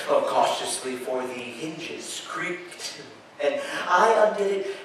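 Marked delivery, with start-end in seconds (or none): 1.0-3.0 hushed and slow. Acted. Narrator describes creeping in secret.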